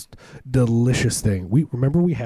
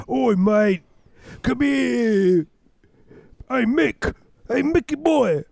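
much higher, put-on voice as a mock Canadian; bad Canadian impression; 13 semitones higher, much slower than usual. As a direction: ham up a wrong-sounding Canadian accent, calling out. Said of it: "doing a Canadian impersonation".

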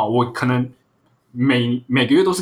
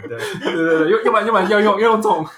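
laughing; 10 semitones higher, 5 dB louder than usual. Real, not acted. Joke about unpaid leave.

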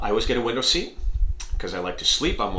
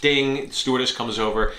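ordinary talk, nothing marked

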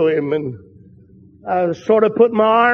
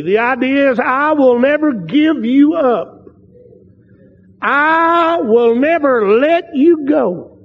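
slow, higher, drawn-out "never"; emphatic preaching; 4 semitones higher, much slower than usual. Shown as a demonstration. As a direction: hammer "never" home with long, stretched phrases. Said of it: "can I give you a little emphasis".

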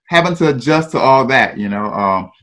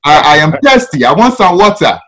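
put-on thick Ghanaian accent, a little louder; thick African accent; 2 semitones higher, 4 dB louder than usual. New kid asking for a drink, thick Ghanaian accent. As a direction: act out a newcomer's thick Ghanaian English. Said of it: "I have this thick accent".

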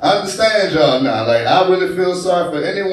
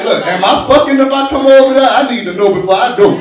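higher, louder, pushy phone plea; urgent mock call; 6 semitones higher, 3 dB louder than usual. Comic pleading to her on the phone at night. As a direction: press her for an answer over the phone. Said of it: "I had to call her all night".

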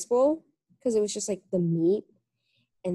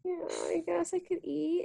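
softer, slow, higher playing it cool; higher, softer coy reply; 8 semitones higher, 8 dB quieter than usual. Telling a friend about the aunt's offer, higher, softer and coy. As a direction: act casual while hungry. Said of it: "I was like".

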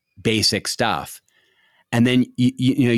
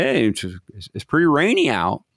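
mock small talker; 2 semitones higher than usual. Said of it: "a stupid observation".

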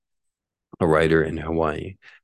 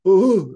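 sheep bleat oh; 13 semitones higher, 8 dB louder than usual. Retold or imitated. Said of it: "it's just like".

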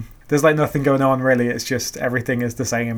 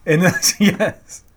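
ordinary talk

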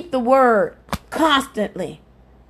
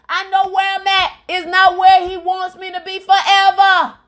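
rising, higher preacher's cadence, pressing each line; preaching at full force; 6 semitones higher than usual. Real, not acted. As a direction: testify with mounting fervour.

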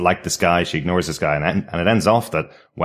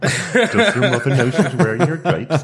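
slow, high, camp theatrical voice with laughter; hammy stage actor; 8 semitones higher, slower than usual. Acted. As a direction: drawl it like a snooty stage diva. Camp joke line, laughing.

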